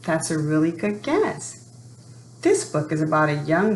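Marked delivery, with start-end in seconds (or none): none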